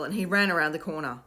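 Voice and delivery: ordinary delivery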